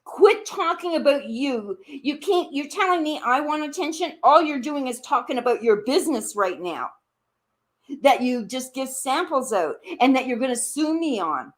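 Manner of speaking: normal talking voice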